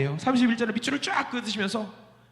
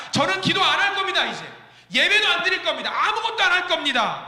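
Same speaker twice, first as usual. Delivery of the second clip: defiant refusal; 11 semitones higher, 3 dB louder than usual. Acted. Believer refusing God defiantly.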